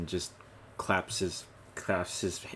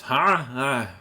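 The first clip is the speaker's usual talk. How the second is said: loud, high forced ha ha; a dwarf laughing off the dismissal; nervous dwarf laugh; 6 semitones higher, 9 dB louder than usual. Acted. Dwarf being sent away, forced loud laugh. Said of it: "Which he responds".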